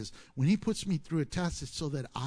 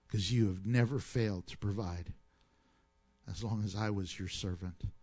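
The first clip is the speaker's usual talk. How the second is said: closing prayer; 4 semitones lower than usual. Acted. Pastor closes in prayer.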